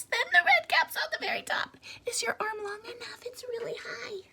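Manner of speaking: high breathy whisper climbing up; soft high whisper reaching up; hushed up high. A narrator's reading. Stacking caps ever higher, hushed.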